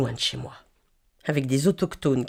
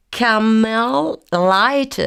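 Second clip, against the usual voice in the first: Camel Light? very slow and louder, Camel stretched; insisting; 6 semitones higher, 6 dB louder, much slower than usual. Shown as a demonstration. Tourist insisting on her cigarette order, slow and loud.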